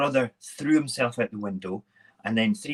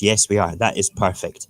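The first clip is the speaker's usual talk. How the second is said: ordinary delivery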